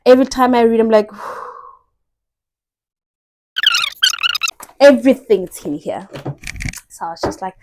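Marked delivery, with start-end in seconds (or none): 3.5-4.7 awed sigh. Real, not acted.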